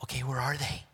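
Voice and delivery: hushed panicked whisper. Retold or imitated. Servant whispering in fear to Elisha. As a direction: whisper it like a scared servant looking around.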